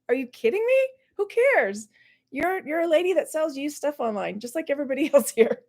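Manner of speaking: high-pitched, scoffing retort; mock incredulous. Vlogger's imagined reply to the boastful YouTuber, mock incredulous. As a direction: puncture her self-importance in disbelief.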